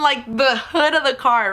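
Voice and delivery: plain reading voice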